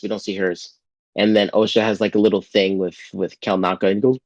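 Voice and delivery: usual speaking voice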